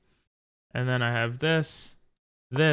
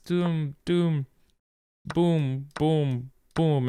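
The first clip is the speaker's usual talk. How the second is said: boom sound effects; 3 semitones higher, much slower than usual. Acted. Playing out the attack.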